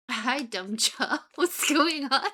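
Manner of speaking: normal talking voice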